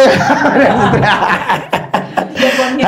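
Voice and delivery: real, sustained laugh; long laugh. Real, not acted. Pastor laughing at people hiding their profits, real laugh.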